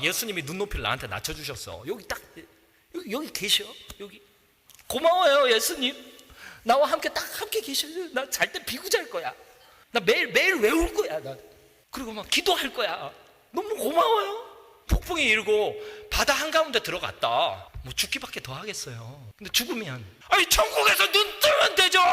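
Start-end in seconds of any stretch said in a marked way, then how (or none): 4.9-14.5 high, excited thanks; hammed-up grateful believer; grateful gushing. Preacher voicing grateful believer. 20.3-22.0 high shout on 뜨면; full-force preaching shout. Real, not acted. Preaching climax on heaven.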